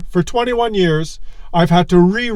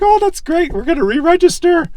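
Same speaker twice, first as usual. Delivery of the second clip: much higher, bright mock-excited wife voice; wife's delighted squeal; 10 semitones higher than usual. Husband imitating his wife's cheerful reply, squealing high. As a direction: play the thrilled wife, pitched way up. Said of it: "And she's like".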